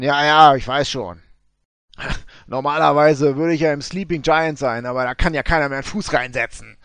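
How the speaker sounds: loud, grumbling prospector voice; gold prospector. Acted. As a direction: boom it out like a scruffy prospector.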